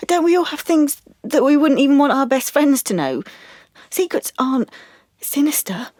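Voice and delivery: high-pitched, upset objection; exasperated protest. Acted. Client denying a love of secrets to therapist, exasperated and upset.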